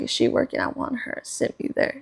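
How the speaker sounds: whispered request; mock whisper. Asking staff for a favorite waitress, whispered. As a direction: whisper it like a secret demand to the host. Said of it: "I was like".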